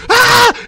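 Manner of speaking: put-on high loud kid scream; imitated scream. Dad imitating his son screaming at the puppet, high loud shriek. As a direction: shriek like a startled child. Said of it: "and then he just goes".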